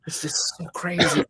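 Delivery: lightly mimicked astonishment; imitated dumbfounded. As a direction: act out her stunned disbelief. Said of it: "she's dumbfounded by the whole thing".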